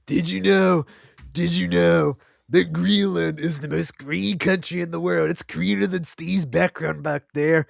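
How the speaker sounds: mock politician. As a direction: drawl it out like a clueless leader.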